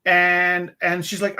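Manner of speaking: ordinary talk, nothing marked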